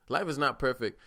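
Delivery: usual speaking voice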